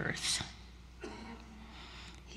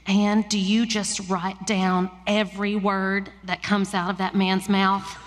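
West Texas lady. Acted. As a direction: put on a folksy West Texas accent. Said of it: "my little West Texas lady voice".

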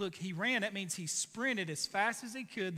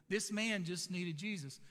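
usual speaking voice

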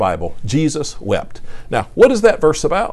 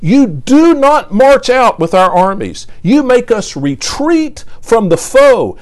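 raised, slow, pleading psalm reading; preacher voicing a psalm of complaint, louder and higher; impassioned scripture reading; 5 semitones higher, 4 dB louder than usual. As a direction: read the lament as a cry to God. Reads psalm of lament aloud.

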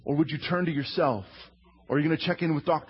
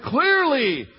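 higher, louder outburst of awe; awed shout; 10 semitones higher, 6 dB louder, slower than usual. Acted. Disciples in the boat, awed, shouting to Jesus. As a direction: cry it out in wonder.

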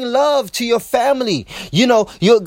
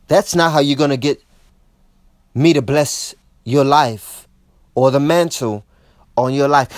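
God's voice; 8 semitones lower than usual. Acted. God voiced in sermon.

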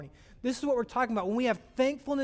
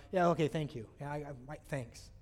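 half-hearted thanks; 6 semitones lower, 6 dB quieter than usual. Pretend. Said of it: "It's not like".

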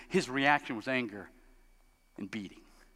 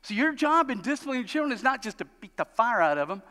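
ordinary delivery

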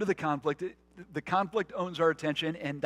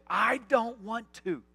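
put-on sulky child; 6 semitones higher, 12 dB louder, slower than usual. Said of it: "To which we say".

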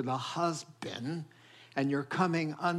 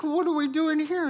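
alarmed question; 11 semitones higher, 5 dB louder than usual. Retold or imitated. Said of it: "I was like".

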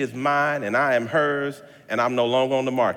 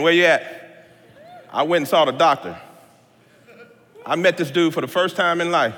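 ordinary delivery

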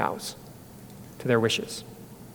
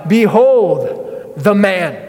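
very slow, louder proclamation; solemn proclamation; 4 semitones higher, 6 dB louder, much slower than usual. Retold or imitated. Pilate presenting Jesus to the crowd, slow and grand. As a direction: draw out each word as a grand unveiling.

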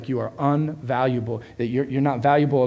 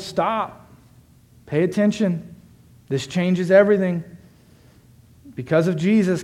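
raised, urgent, emphatic preaching; 6 semitones higher, 6 dB louder than usual. Real, not acted. Preacher saying God now dwells among people, raised preaching. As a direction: press the promise on the congregation.

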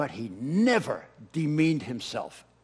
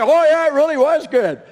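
higher and louder, pushing the praise back at him; insistent mock praise; 10 semitones higher, 9 dB louder than usual. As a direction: mimic people gushing back at you.

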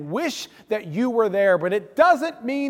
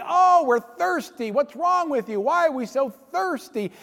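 mock complaining; 7 semitones higher than usual. Thirsty Israelites griping at Moses, imitated as whining. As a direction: mimic a crowd griping.

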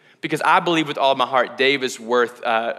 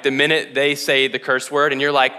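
usual speaking voice

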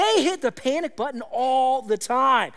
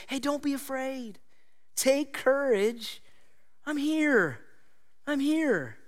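gentle reassurance; 5 semitones higher, slower than usual. Acted. Jesus calming his disciples, gentle and slow. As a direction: soothe a frightened friend.

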